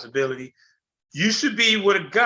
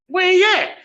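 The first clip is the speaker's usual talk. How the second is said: high, loud demand; sharp demand; 11 semitones higher, 5 dB louder than usual. Retold or imitated. Angry father to daughter about her boyfriend, loud demand.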